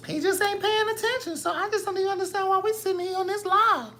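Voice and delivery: very high squeaky mock complaining voice; squeaky viewer voice. Mocking viewers whining that she ignores the comments, very high and squeaky. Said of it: "Y'all talk like this".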